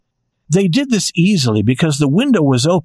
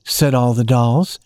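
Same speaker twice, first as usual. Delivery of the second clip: ordinary delivery, nothing marked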